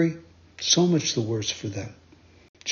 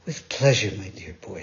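kind woman; 6 semitones lower than usual. Acted.

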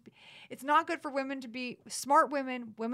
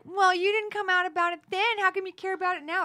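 higher, louder imitation of a doubter; mock challenge; 7 semitones higher, 5 dB louder than usual. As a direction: lightly mimic someone challenging a late accuser. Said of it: "someone would be like".

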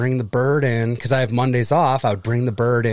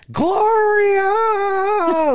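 high, loud squawking shout of a name; parrot squawk; 4 dB louder than usual. Retold or imitated. Grandson imitating grandma's parrot, a loud high squawk. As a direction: screech it like a parrot. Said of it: "All it would ever say is".

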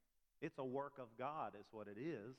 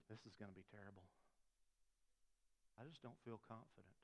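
much softer, low self-talk; dejected; 4 semitones lower, 13 dB quieter, faster than usual. Retold or imitated. Preacher replays his morning thoughts after bad week, quietly dejected. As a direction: imitate a discouraged thought, very quietly.

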